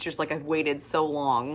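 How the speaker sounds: ordinary talk, nothing marked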